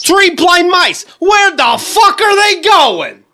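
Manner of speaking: slow, high crude comic shout; Dice Clay nursery rhyme. Retold or imitated. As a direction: bellow a dirty nursery rhyme slowly. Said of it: "just saying nursery rhymes now".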